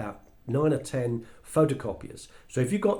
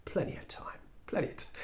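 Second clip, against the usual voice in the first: hushed, breathy whisper, unhurried; 4 semitones higher, 11 dB quieter than usual. Retold or imitated. Larry to the broke speaker, whispering. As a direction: whisper it like it's no worry at all. Larry calmly brushing off the deadline.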